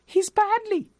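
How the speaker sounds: put-on high boy's voice answering the cashier; piped. A narrator's reading. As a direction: pipe it like a small lad. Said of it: "piped the boy".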